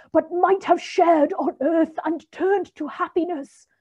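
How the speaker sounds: high, loud wailing spirit; Marley's ghost demanding belief from Scrooge; ghostly voice. A narrator's reading. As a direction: ring it out like a tormented ghost. The ghost speaks.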